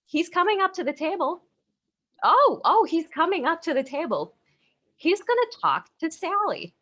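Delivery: high, rising mock panic; retold dread building to excitement; mock alarm. Friends watch ex approach table.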